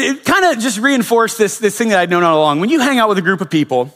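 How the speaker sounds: ordinary talk, nothing marked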